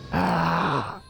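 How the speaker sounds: high, sharp cry of grief; desperate shout of loss; anguished cry. Acted. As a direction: let out a sudden wail of pain. Cry of shock after calling parents.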